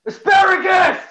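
high, loud shout of one word; sudden full-voiced holler; yelled. Shown as a demonstration. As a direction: yell it out on a dare. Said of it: "you told me to yell asparagus".

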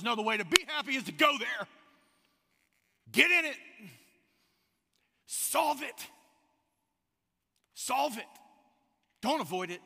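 loud, high, insistent exhortation; urgent command. Acted. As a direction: push them to face it head on. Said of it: "And Kohelet says".

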